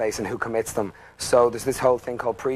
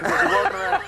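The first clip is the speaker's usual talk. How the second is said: laughing. Real, not acted. Laughing while telling the story.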